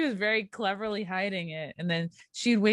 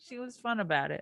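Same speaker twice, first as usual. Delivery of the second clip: normal talking voice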